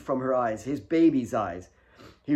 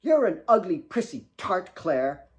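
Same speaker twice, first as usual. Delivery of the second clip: hissed insult; 6 semitones higher than usual. Acted. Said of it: "Kate hissed at her sister".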